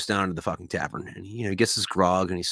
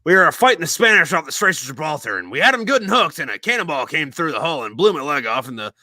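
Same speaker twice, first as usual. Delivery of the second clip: loud, high swaggering pirate yarn; boastful old pirate; 7 semitones higher, 6 dB louder than usual. Acted. Old pirate bragging to the young sailor about his peg leg. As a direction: brag like an old sea dog. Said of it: "He's like".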